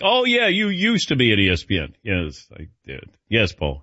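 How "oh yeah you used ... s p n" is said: higher-pitched mock recognition; higher, offhand quoted reaction; imitating dismissive acquaintances. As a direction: lightly voice someone recalling your old job. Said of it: "you know, you're like".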